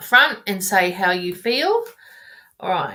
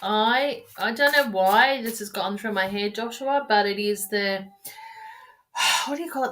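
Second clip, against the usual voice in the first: normal talking voice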